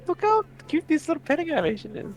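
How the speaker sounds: high laughing squeal. Real, not acted. Laughing at cute animation.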